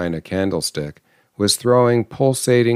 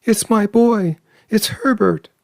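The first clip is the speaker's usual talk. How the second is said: desperate cry; 14 semitones higher than usual. A narrator's reading. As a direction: cry out straining toward the door. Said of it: "she cried".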